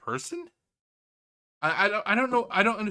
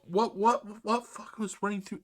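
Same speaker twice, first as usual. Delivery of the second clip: ordinary talk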